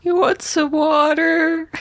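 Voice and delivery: high, slow mock coddling; mock tender echo. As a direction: coo it like to a fussy child. Echoing friend's mock caring line.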